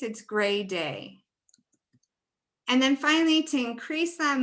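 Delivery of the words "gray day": "gray day" slowly, with the long ay drawn out; slow color name. Shown as a demonstration. Teacher naming the vowel color "gray day" slowly. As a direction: drawl the ay in "gray day" a little. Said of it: "So in this case".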